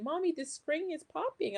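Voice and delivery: put-on a young daughter pulling her wagon, calling up high; daughter's voice. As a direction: imitate a little girl's complaint. Mother retells daughter's complaint.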